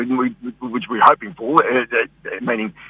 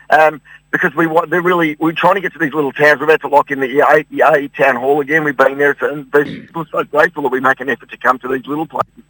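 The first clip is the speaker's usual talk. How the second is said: normal talking voice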